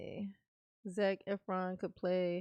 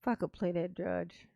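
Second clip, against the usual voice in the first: ordinary talk, nothing marked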